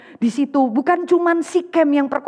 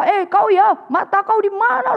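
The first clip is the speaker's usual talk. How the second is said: angry retort; 7 semitones higher than usual. Retold or imitated.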